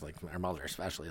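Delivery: ordinary delivery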